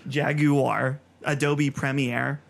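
Jaguar as "jag-you-ar", then Premiere spelt out by ear; British-style pronunciation. Shown as a demonstration. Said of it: "like that?".

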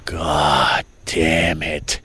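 low, slow, half-crying groan of delight; slow drawn-out moan of pleasure. Real, not acted. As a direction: moan it like the taste is too good. Moaning over pie with ice cream.

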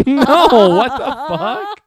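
high loud laughing disbelief; shrieking laugh. Real, not acted. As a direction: squeal it out laughing. Shocked by Chewbacca fart video.

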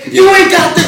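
high-pitched mock-furious shout; mad rant. Rapper ranting at radio host Sway, mock-furious shout. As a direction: yell it like an outraged celebrity guest. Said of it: "Yo was mad as shit".

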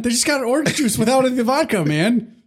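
high, frantic Always Sunny whine; Charlie Day rant. Retold or imitated. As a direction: complain like a manic barfly. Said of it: "Always Sunny".

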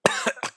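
sudden cough as a drink goes down wrong; choking cough. Real, not acted. Host choking on his cola mid-show, coughing. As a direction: cough it out, caught off guard. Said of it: "I nearly choked".

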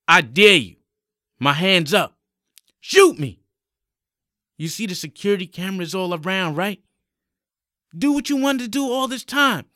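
high, loud taunting; angry defiance. Acted. Young man daring armed policeman to shoot, angry high defiance.

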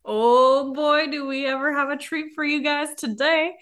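loud, high excited announcement. Real, not acted. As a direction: hype up the big reveal. Excited show host announcement.

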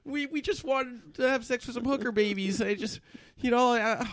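put-on squeaky teen boy voice; high kid voice. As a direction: play it high like a nervous kid. Kid voice continues, high pitched.

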